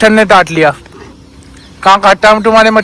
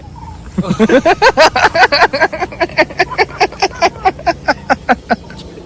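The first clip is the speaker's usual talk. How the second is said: long high laugh that falls away; 10 semitones higher than usual. Real, not acted. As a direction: burst out laughing and let it die down. Laughing at the child's joke. (लंबी खिलखिलाती हँसी)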